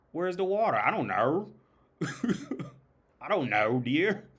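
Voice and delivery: high, loud mock Aussie exchange; playful Australian accent bit. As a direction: ham up an Aussie couple. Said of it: "they put R's where R's don't go".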